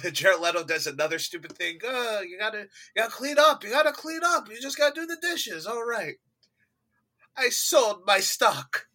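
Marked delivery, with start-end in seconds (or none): 1.8-6.1 high, hurried mock pleading; Al Pacino. Podcaster mimics actor nagging about cleaning up, high and fast. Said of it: "Al Pacino's like". 7.4-8.9 high, slow, drawn-out actor impression; Al Pacino. Podcaster mimics actor's confession, slow and high. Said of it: "Al Pacino's like".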